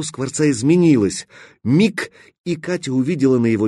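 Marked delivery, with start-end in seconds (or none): none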